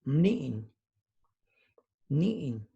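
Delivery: "nin" twice with a rising tone; Zapotec word. Shown as a demonstration. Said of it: "join me in saying the words".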